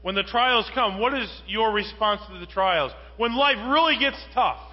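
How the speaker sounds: fervent preacher building to a climax; building preaching. Acted. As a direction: climb with each clause.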